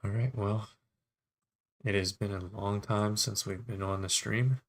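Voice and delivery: normal talking voice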